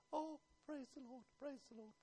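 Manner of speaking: mock feeble praise. Preacher mocking timid worshippers, faint half-hearted praise. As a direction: mumble it like you barely mean it. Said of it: "They didn't say".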